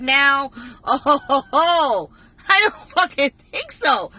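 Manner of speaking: loud, high mocking ho ho; loud, high scoffing laugh and refusal; outraged. Real, not acted. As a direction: cackle then outraged refusal. Outraged rant about mom.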